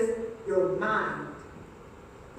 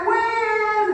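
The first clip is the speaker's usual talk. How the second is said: shouted call; 8 semitones higher, 6 dB louder than usual. Acted. Leading crowd call-and-response.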